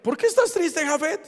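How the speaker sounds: tender, high-voiced inquiry; Jesus to a sad man. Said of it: "Jesús le dice a Jafet".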